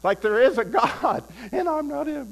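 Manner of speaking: high, loud retold cry of revelation; imitating a man overcome mid-sentence; mock tearful outburst. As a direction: cry it out as if bursting into tears. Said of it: "I just burst into tears".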